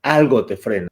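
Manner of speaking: usual speaking voice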